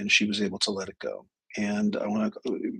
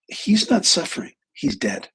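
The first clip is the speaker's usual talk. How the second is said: higher-pitched indignant start, dropping on the last phrase; pissed-off retort; 8 semitones higher than usual. Retold or imitated. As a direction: retell a nurse's angry comeback. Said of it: "really pissed now".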